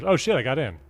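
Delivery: normal talking voice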